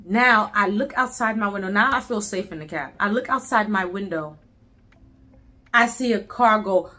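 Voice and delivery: plain reading voice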